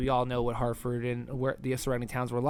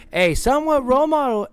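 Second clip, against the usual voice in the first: younger self voice; 9 semitones higher, 7 dB louder than usual. Retold or imitated. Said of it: "as young [name] would say".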